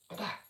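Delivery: sharp, high "ba-ba" hit noise; mock impact sound. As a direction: blurt it like a quick one-two strike. Imitating a quick hit sound.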